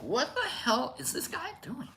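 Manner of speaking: high, soft mock-bewildered voice; baffled viewer. Host imitating a viewer of the show, high and baffled. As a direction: imitate an onlooker in a small high voice.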